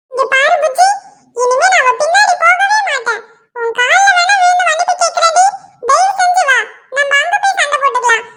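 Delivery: hammed-up husband falling at his wife's feet; begging husband. Husband begging wife again.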